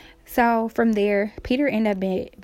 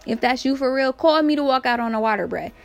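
raised-pitch retold challenge; mock calling out; 4 semitones higher than usual. Peter calling to Jesus on the water, mock call. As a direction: call it out high, playfully cocky.

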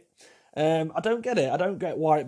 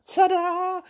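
very high showy flourish; mock ta-da; 4 dB louder than usual. Nan in net curtains unveils her outfit with a very high flourish. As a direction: sing it out like a grand reveal.

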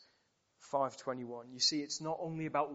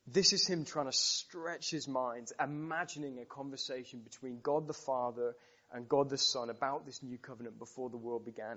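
ordinary delivery

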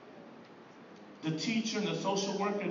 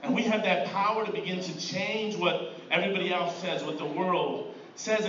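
normal talking voice

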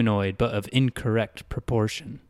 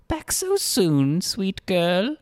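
higher, slow, teasing greeter voice; giggly greeter; 9 semitones higher, slower than usual. Acted. Greeter teases the girl who ran back, higher and slow. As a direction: tease her like a grinning host. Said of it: "The greeter waved his arm and giggled".

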